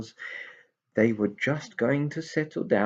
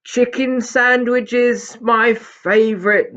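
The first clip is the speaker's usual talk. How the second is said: much higher, louder, slow squeal; delighted; 11 dB louder, much slower than usual. Acted. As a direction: swoon over the sandwiches. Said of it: "said Binky in delight".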